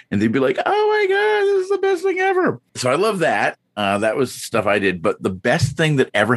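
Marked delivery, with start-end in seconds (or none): 0.6-2.6 imitating thrilled strangers in a very high squeal; overjoyed. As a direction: gush in a hammed-up, sky-high voice. Delighted strangers given free passes.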